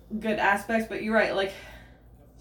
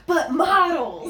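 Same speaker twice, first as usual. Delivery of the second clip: high, loud and slow, laughing; laughing mock excitement; excited; 6 semitones higher, 5 dB louder, much slower than usual. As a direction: drag it out, thrilled about models. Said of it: "'cause Schmidt's like".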